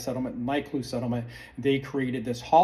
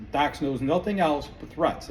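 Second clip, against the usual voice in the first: usual speaking voice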